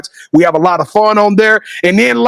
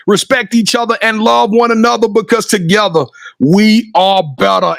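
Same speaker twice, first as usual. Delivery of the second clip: usual speaking voice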